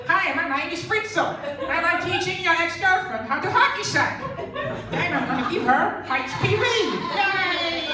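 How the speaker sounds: smug carefree European traveller; Euro backpacker. Retold or imitated.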